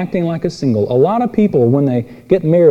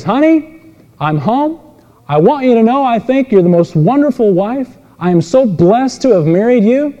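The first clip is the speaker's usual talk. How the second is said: gushing husband; 8 semitones higher than usual. Retold or imitated. Husband bursting in to praise his wife, hammed-up gushing.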